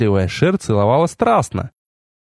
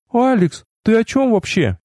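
much higher, puzzled female character; baffled girl's voice; 9 semitones higher than usual. A narrator's reading. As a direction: protest in a high girlish voice. Narrator voices the baffled girl. (высокий недоумевающий девичий голос)

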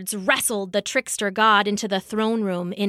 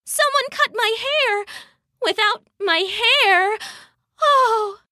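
very high, wailing lament; hammed-up feeble moan, way up high; weak moan; 13 semitones higher than usual. Sif struggling to rise without her hair, weak high moan. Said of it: "Sif moaned weakly".

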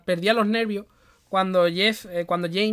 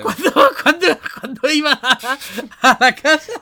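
high, loud, words broken by laughter; barely getting it out through laughter; laughing while talking; 6 semitones higher, 8 dB louder than usual. Real, not acted. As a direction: crack up mid-sentence. Cracks up telling the story.

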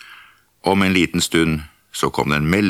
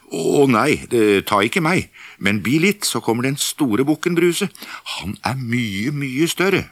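higher, pleading goat; middle goat voice; 6 semitones higher than usual. Acted. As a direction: beg the troll to wait.

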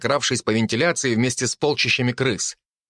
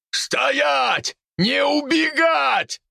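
shouting; much slower than usual. Acted. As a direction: yell at the fleeing pigs. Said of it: "кричал мужчина".

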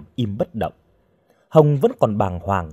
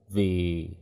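low, soft, tailing into silence; hesitant; 5 semitones lower, 7 dB quieter than usual. A narrator's reading. Guard starting to explain himself, hesitantly. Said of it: "Gã áo đen ngập ngừng".

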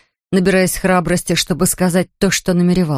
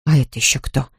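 low, grim question; suspicious cousin, low and gloomy; 5 semitones lower than usual. A narrator's reading. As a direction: mutter it darkly. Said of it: "мрачно спросила Шарлотта".

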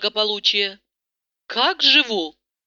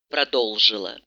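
usual speaking voice

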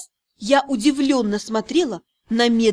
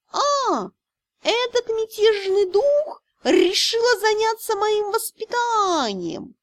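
high, slow, lazily amused; lazy benevolent; 9 semitones higher, slower than usual. A narrator's reading. Cat about the rebellious spirit, lazy and benevolent. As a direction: let it drip with lazy goodwill. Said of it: "лениво-благожелательный тон".

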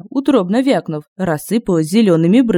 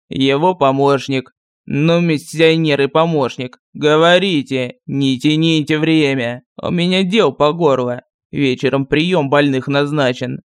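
lightly voiced village sorcerer; gruff sorcerer voice; 3 semitones lower than usual. A narrator's reading. Sorcerer voice held. (ворчливый голос колдуна)

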